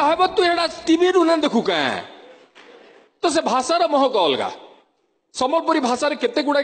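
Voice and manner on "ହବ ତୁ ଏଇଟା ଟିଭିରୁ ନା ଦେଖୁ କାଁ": higher Sambalpuri voice; Western Odia dialect. Retold or imitated. The friend's answer, in Western Odia. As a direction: quote the reply in Sambalpuri. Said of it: "ସେ କହିଲେ".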